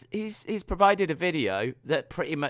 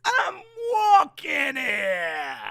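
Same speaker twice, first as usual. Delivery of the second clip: slow, much higher, shouted New York "I'm walking here"; full New York accent; 9 semitones higher, much slower than usual. Acted. Repeating the line in a full, slow, higher New York accent. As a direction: bellow it like a New York cabbie in traffic. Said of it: "that's not Boston".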